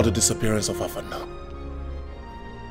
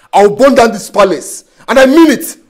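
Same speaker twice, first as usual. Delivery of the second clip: rising furious vow, louder at the end; hammering out an ultimatum; angry threat; 4 semitones higher, 6 dB louder than usual. Acted. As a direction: threaten them, building to a shout.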